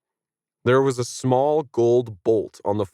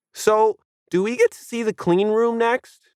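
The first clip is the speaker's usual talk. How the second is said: badgering kid; 13 semitones higher than usual. A narrator's reading. Kid badgering the tour guide, bratty. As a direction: pester like a know-it-all kid. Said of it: "the kid badgered".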